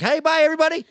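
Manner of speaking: high, loud quick farewell; cheery goodbye. Retold or imitated. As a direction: wave off the crowd brightly. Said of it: "I'm just like".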